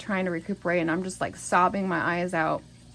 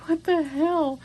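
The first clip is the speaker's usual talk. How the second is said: shocked disbelief; 9 semitones higher, slower than usual. Retold or imitated. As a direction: blurt it out, caught off guard. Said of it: "And I'm like".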